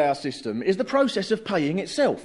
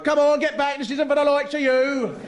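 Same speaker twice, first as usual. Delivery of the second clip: higher, lightly raised scolding; officious guard; 8 semitones higher than usual. Acted. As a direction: shoo them back like a guard. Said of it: "a guard comes round and goes".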